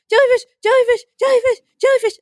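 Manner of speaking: very high mock-panic squeal; tiny frantic voice crying out, played for laughs; panicked little voice. Swimmer flailing above a wreck after a jellyfish hit, mock panic. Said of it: "little flailing limbs and this voice going".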